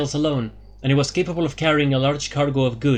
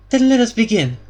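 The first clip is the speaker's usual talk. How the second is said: higher, louder leader's voice; Elara, resolute; 11 semitones higher, 6 dB louder than usual. A narrator's reading. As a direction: launch the quest as Elara.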